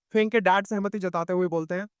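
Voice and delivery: ordinary delivery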